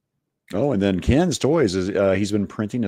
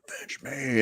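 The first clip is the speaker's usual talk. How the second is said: hammed-up dying plea; mock dramatic last cry; overacted death scene; 6 semitones higher, 5 dB quieter than usual.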